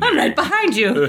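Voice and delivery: creepy priest voice. Retold or imitated. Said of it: "He's like".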